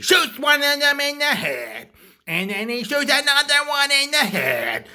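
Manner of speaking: high, loud, shouted story climax; excited retelling. Retold or imitated. Teller recounting subway shooting, excited high shout. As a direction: shout the action blow by blow.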